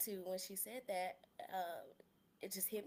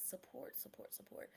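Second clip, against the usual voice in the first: whispered; 3 semitones higher, 8 dB quieter than usual. Real, not acted. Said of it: "whispering the word to herself".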